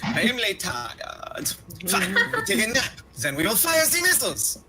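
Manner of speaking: high cartoon French accent; hammed-up French cartoon voice. Quoting internet cartoon's French character.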